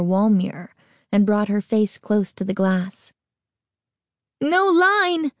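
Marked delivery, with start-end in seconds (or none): 4.4-5.3 high panicked cry; frightened cry. A narrator's reading. Girl crying out at her mirror, high panicked cry. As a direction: cry out in sudden fear. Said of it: "she cried".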